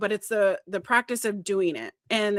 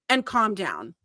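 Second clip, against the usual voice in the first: ordinary talk, nothing marked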